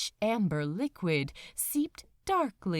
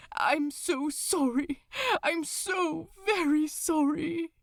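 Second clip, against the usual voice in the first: grieving apology; 8 semitones higher, 3 dB louder than usual. Acted. Angel apologises to doomed friend.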